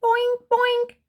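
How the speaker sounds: high, bouncy bunny; boing along like a hopping rabbit; Bunny answers Puppy, high and bouncy. Acted. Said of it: "says Bunny".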